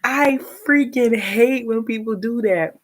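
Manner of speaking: high, loud burst of irritation; exasperated. Real, not acted. Venting after mimicking her mother.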